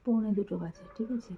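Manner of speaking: hushed late-night talk; speaking softly. Real, not acted. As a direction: keep your voice down, people sleep. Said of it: "আমরা এত আস্তে আস্তে কথা বলছি". (রাতে চাপা গলায় আস্তে কথা বলা)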